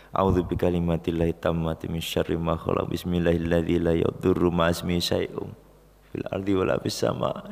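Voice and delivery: low, soft prayer recitation; recited prayer. Real, not acted. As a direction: murmur the prayer devoutly. (doa dilantunkan pelan)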